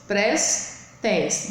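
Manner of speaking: "prestes" split into pres, tes; two even, separate beats on "prestes"; syllable by syllable. Shown as a demonstration. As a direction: sound out "prestes" with a pause in the middle.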